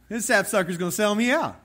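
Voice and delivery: hammed-up outraged tattling; mock indignation. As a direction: loudly tell on him to the whole table. Acts Jesus exposing Judas, comically.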